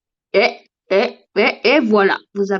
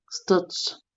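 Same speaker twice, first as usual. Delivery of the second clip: low, soft mock séance call; summoning call; 4 semitones lower, 9 dB quieter than usual. Someone summons Stitch in put-on voice. (appel bas et feutré, comme une invocation)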